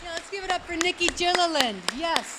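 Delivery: high, loud hype for the crowd; excited host. Real, not acted. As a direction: pump up the audience. Host hypes crowd after speaker.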